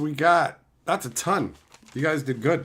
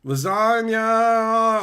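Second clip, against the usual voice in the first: long-held, higher and louder name call; drawn-out call; 4 semitones higher, 6 dB louder, much slower than usual. Real, not acted. Streamer hails a viewer in chat, drawn-out call. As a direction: stretch the name out long and loud.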